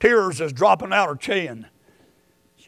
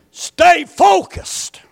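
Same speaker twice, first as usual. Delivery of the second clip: slow shout; 10 semitones higher, 7 dB louder, much slower than usual. Real, not acted. Preacher driving his point home to the church, slow and shouting. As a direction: stay focused, shouted slow and high.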